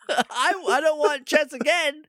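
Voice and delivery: high, whiny-indignant demand played for laughs; comic high-pitched protest; mock outrage. Comic tantrum about wanting a sequel.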